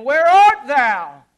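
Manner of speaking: loud, high, slow cry of Adam's name; booming divine call. Pretend. God calling to hiding Adam, a booming cry. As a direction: call out across the garden.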